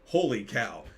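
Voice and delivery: ordinary delivery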